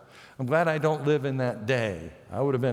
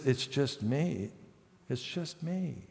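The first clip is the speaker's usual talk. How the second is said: humble quiet; 3 semitones lower, 8 dB quieter, slower than usual. Retold or imitated. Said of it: "And he says".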